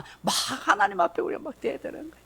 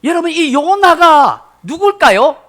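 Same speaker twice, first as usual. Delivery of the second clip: slow, loud and higher, drawn out; emphatic question; 7 semitones higher, 7 dB louder, slower than usual. Real, not acted. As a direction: ask the room slowly with weight. Dramatic question to congregation. (천천히 힘주어 묻는 목소리)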